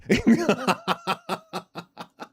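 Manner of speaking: high, bursting laugh; delighted laugh. Real, not acted. Dad cracking up remembering his son's pillow game, high delighted laugh. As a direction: let the laugh spill out high and free.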